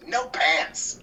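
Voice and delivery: high, loud gleeful quote; imitating an impish outburst; devilish glee. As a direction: blurt it through a devilish grin. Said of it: "that devilish grin, and she goes".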